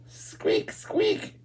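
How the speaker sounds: breathy, very high little squeaks; whispered mouse squeaks. Pretend. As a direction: squeak softly like a hidden mouse.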